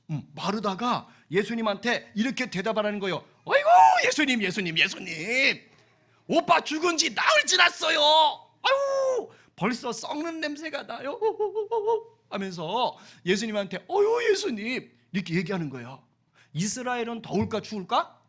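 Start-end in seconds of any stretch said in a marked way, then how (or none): 3.5-11.2 high, loud wailing plea; Martha pleading. Acted. Martha voiced pleading to Jesus. 13.9-14.9 high, slow sighing plea; Martha sighing. Acted. Martha voiced sighing to Jesus.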